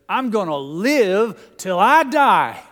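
loud, slow, hammed-up TV character catchphrase; Boss Hogg. Preacher imitating a TV character for the congregation, hammed-up and booming. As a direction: boom out the character's line, dragging the words. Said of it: "that character, Boss Hogg, used to say".

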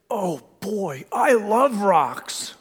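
high, slow hammed-up delight; mock delight. Son-in-law hearing he gets a rock, mock-thrilled and slow. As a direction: stretch it out like a kid at Christmas.

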